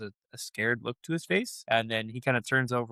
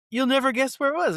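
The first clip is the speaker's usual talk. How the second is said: high, loud teasing excitement; excited news; 13 semitones higher, 6 dB louder than usual. Retold or imitated. Retelling the coach offer, excited high voice. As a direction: tease the big news.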